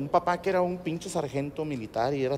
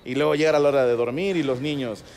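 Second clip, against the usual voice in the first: usual speaking voice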